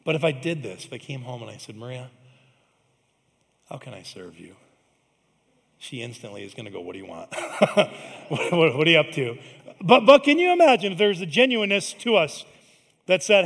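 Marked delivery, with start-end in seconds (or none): 1.8-4.6 soft, low, gentle question to his wife; husband quietly offering to serve his wife; tender offer. Pretend. 6.8-9.4 laughing, suspicious mock wife; wife laughing at husband's sudden kindness. Wife's suspicious reply.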